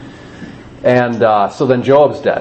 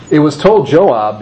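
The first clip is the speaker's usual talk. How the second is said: ordinary talk, nothing marked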